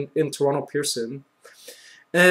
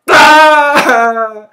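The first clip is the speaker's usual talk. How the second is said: long, high, loud scream; mock-terrified drawn-out wail; retold panic scream; 11 semitones higher, 11 dB louder than usual. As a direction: scream "ah" as if the plane is dropping. Retelling own panic during turbulence.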